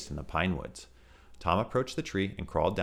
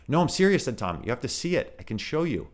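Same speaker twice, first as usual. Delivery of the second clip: earnest pleading; 8 semitones higher, 4 dB louder than usual. Acted. Tom pleads.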